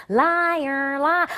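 imitating a heckling demon; taunting demon. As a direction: jeer over the singing. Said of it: "this demon all around my head going".

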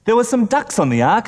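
ordinary delivery

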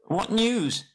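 high, loud hail in a shaky voice; shaky call. Acted. Bilbo hailing a man climbing towards him, shaky high call. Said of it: "he called with a shaky voice".

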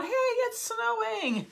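high, slow, giggly snowman voice; playful snowman cheering about the snow. Retold or imitated. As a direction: squeak it out like a delighted little snowman. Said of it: "It's the snowman going".

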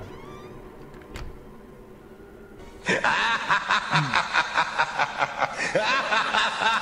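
loud real laughter; long laugh. Real, not acted. Laughs at the slap ability.

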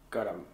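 Gorum with a clear joining vowel, stressed GOR-um; Gaelic pronunciation. Shown as a demonstration. Repeating the Gaelic word for the learner, GOR-um stressed first. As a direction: lean on the first syllable of Gorum. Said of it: "a joining vowel in between R and M".

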